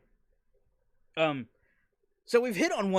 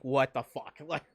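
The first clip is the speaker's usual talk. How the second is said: bewildered; 6 semitones higher, 7 dB louder than usual. Retold or imitated. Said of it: "I'm like".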